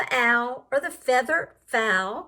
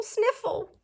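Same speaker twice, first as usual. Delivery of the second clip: sniffling word; 6 semitones higher, 5 dB quieter than usual. Acted. Flea sniffles in the story.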